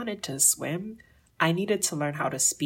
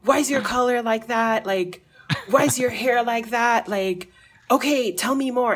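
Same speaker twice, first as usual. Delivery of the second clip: louder, higher, eager questioning; curious taxi driver; 7 semitones higher, 6 dB louder than usual. Retold or imitated. Taxi driver quizzing her, eager and loud.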